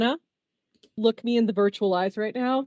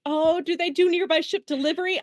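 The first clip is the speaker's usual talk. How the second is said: excited question, higher pitch; 7 semitones higher than usual. Real, not acted. Player jumping at a ship delivery idea, very excited. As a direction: blurt it out, getting carried away. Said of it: "I'm getting very excited".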